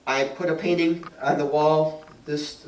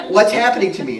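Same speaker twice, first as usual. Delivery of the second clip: loud, high retold bewilderment; mock alarm; 6 semitones higher, 8 dB louder, faster than usual. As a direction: exclaim it as acted-out panic. Acts out own alarm while channeling.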